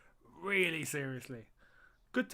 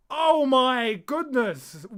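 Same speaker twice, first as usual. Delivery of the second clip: slow, drawn-out, louder gasp of disbelief; stunned exclamation; 6 semitones higher, 9 dB louder, much slower than usual. Real, not acted. Streamer reacts to Kepa's near blunder, slow stunned exclamation. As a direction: stretch it out in shock. Relief after keeper scare.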